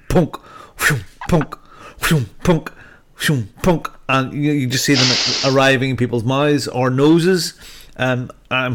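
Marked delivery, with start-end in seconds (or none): 0.1-4.5 whoosh-and-plonk sound effect; playful cartoon projectile noises, evenly spaced; imitated throwing noises. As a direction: mimic repeated throws landing, each a short popping "punk". Imitating hot dogs thrown at people.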